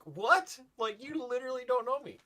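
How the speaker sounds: mock outrage at being misread; high pitched incredulous protest; indignant what. Retold indignant reaction.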